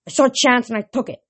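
loud quick comeback; fast angry retort. A narrator's reading. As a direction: snap back defiantly. Hero arguing with Thalia.

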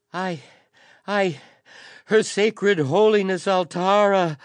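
high, loud, faltering stammer; stammering in terror. A narrator's reading. Terrified courier stammering bad news to the council. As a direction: choke out the holy name in dread.

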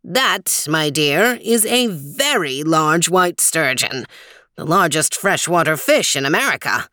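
lightly voiced old man, measured and helpful; a hint of a friendly old guide, unhurried; old man Weezer. Weezer explains.

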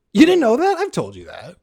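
teasing disbelief at a friend; mock surprise. Real, not acted. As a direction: act shocked they never heard.

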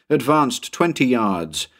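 plain reading voice

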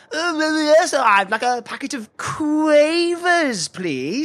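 high, slow, drawn-out mimicry of the shop assistant; mocking ninny voice. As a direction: stretch "Quavers" into a long silly KWAY-vers. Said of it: "You sound like a complete ninny".

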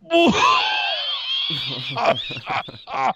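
high whoop breaking into coughs; long exaggerated exhale, then hacking; mock smoker's cough. First hit of a joint, mock coughing fit.

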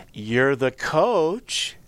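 very slow, drawn-out mocking question; smirking student. Giggling player testing the new coach, smirking. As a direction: stretch it out with a cheeky smile. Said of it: "looks at me and smiles and says".

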